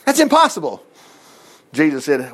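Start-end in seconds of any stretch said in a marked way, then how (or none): 0.1-0.8 mock doubt. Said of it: "That happened here in a few chapters later too".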